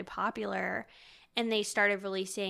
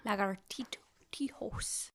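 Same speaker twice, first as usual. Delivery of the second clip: whispered attempt; 7 dB quieter than usual. Real, not acted. Trying Spanish word softly.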